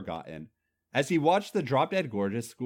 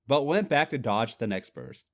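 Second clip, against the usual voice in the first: plain reading voice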